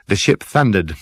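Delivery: ordinary delivery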